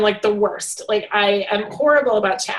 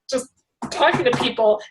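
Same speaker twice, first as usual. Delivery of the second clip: ordinary talk, nothing marked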